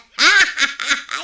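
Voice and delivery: a loud, hearty ha-ha-ha; loud laugh. Acted. Gossip to her friend about the councilor, a loud hearty laugh. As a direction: burst out laughing at your own joke.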